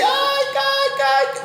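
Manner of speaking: high sung whimper like a hurt dog; sad animal whine. Retold or imitated. Preacher imitating a scolded dog's high whimper. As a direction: whine like a sad pet that got hit. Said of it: "se siente triste, dice".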